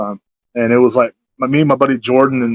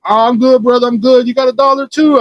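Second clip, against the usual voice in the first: high-pitched imitated street panhandler; crackhead panhandler; 10 semitones higher than usual. Storyteller imitating a crackhead asking for a dollar, high panhandler voice. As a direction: pitch it high, eagerly hitting someone up. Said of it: "this crackhead out there".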